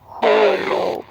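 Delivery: whispery robotic voice changer. Shown as a demonstration. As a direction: electronic hiss filter on the word. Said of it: "My favorite setting is".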